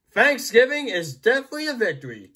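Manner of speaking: ordinary talk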